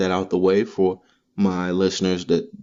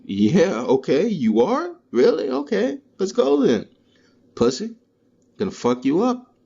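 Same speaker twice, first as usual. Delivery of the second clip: mocking, much higher pitched trash talk; cocky taunt; 11 semitones higher than usual. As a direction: egg the challenger on, mockingly high. Taunting someone challenging him.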